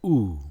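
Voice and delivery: Ooh with lips puckered; demo sound. Shown as a demonstration. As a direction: round the lips for a clear Ooh. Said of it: "pronounce this".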